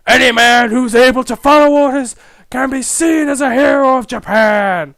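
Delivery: loud, high rallying proclamation; patriotic recruiter. Acted. Army recruiter rallying passers-by, loud patriotic proclamation. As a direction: proclaim it to the whole crowd.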